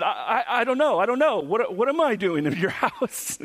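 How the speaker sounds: high-pitched, stammering mock panic; flustered panic. Teenager asked what he is doing at gunpoint, hammed-up flustered panic. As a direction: babble helplessly, imitating a frightened blank mind. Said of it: "forgot what I was doing".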